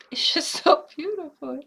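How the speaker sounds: overwhelmed sigh, then laugh through tears; sigh and tearful laugh. Real, not acted. Moved by the rubber's essence, sighing and laughing. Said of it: "This is really emotional".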